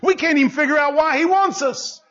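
high, exultant preacher delivery; leidenschaftliche Predigt. Real, not acted. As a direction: proclaim it with amazement, pitched high. Preaching at full force.

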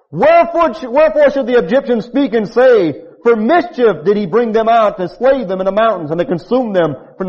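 high, loud pleading scripture; preaching at full force. Acted. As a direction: plead boldly with God.

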